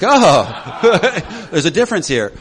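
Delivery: laughs through the words; laughing quote. Real, not acted. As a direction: say it while laughing.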